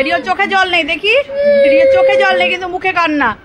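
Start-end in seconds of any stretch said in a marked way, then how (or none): 0.8-1.1 high, louder mock narration of his crying; mother teasing her crying son for the camera; teasing commentary. Real, not acted. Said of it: "Rio কিভাবে কাঁদছে". 1.7-2.5 much higher and louder teasing cry; teasing exclaim. Real, not acted. Repeat of teasing line, much louder. 2.5-3.4 high, louder mock narration of his crying; mother teasing her crying son for the camera; teasing commentary. Real, not acted. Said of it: "Rio কিভাবে কাঁদছে".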